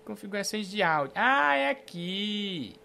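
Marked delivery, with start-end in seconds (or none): none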